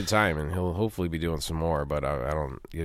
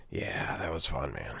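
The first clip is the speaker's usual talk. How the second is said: whisper; 5 semitones lower, 10 dB quieter, slower than usual. Real, not acted. Tired after late night.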